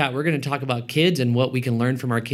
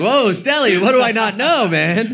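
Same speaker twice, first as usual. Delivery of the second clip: higher, playful astonishment; mock surprise; 7 semitones higher, 3 dB louder than usual. Co-host teasing his friend's slip about his son, mock surprised. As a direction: react as if to shocking news.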